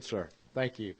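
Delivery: ordinary talk, nothing marked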